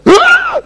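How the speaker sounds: short, very high mock scream; mimicked crowd scream. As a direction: let out a shrieking gasp of the shocked crowd.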